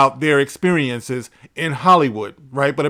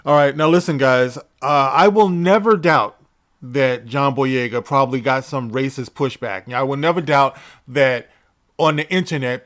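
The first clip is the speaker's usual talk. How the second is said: ordinary talk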